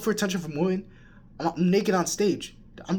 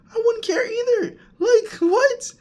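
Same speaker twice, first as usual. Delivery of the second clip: much higher mock crying whine; 6 dB louder, slower than usual. Mocking a random girl's gossip, high mock whine. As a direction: whine it like a hammed-up cry.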